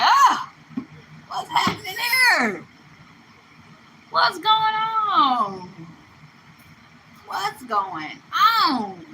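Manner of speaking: panicked mama. Retold or imitated. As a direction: cry out like a flustered mother in a panic. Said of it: "Your mama gonna be like".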